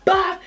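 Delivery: one high, loud bop; imitated blow. He goes for best friend, high loud smack noise. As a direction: pop out a sudden hit noise.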